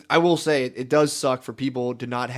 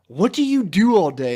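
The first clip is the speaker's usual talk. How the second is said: higher, louder mock exasperation; nagging mom; 6 semitones higher, 6 dB louder than usual. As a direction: imitate a mom demanding an answer.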